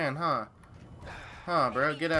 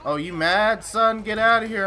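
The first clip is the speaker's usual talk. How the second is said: rising taunt; 7 semitones higher, 6 dB louder than usual. Real, not acted. Taunting a killed enemy.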